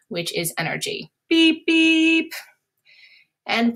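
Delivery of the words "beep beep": high, loud car-horn honk; car horn beep. Shown as a demonstration. Teacher showing the class a car horn, high loud honking beeps.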